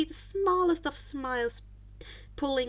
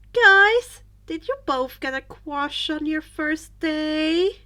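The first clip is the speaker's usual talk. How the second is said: teasing; 4 semitones higher, 9 dB louder, slower than usual. Acted. Teasing friend.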